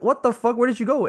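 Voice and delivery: retold outrage. Narrator to missing friend at 5 am, mock-furious and high. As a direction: throw the question at him like a worried friend. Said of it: "I'm like".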